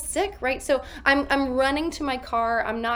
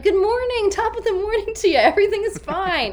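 high, loud, fast chipper act; fake cheerful greeting; 9 semitones higher, 5 dB louder, faster than usual. Masked actor greeting coworkers with forced cheer. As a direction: chirp it out like nothing is wrong. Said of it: "but I'm like".

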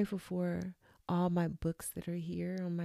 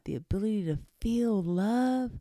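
higher, louder burst of feeling; excited outburst; 5 semitones higher, 6 dB louder than usual. Real, not acted. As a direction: let the joy spill out on love. Moved by feeling love.

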